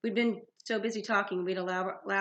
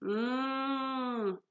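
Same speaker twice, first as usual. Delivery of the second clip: long, higher-pitched closed-mouth moo; lowing; 5 semitones higher than usual. Acted. Bull serpent lows at talk of entrails, lowing. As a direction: hold a plaintive cow-like "mmm". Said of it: "Bessie lowed".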